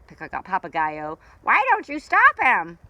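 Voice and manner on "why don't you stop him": parrot voice. Acted. Parrot asks creatures.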